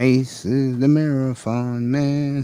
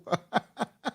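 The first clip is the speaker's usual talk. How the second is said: high laugh; 12 semitones higher, 5 dB quieter than usual. Real, not acted. Laughing after joke about Jerome.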